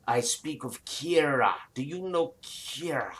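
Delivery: dragon murmurs a question. Acted. GM voices dragon.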